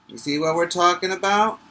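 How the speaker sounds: ordinary delivery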